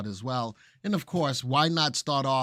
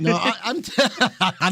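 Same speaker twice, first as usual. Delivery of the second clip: laughing; 5 semitones higher, 7 dB louder than usual. Real, not acted. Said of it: "that's how we're gonna lose viewers".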